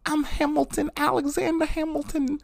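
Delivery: very high, far-off put-on voice for the hero's entrance; imitating Hamilton. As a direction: squeak it out like a grand stage introduction. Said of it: "when he comes out and he's like".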